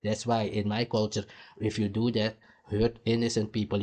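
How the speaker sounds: usual speaking voice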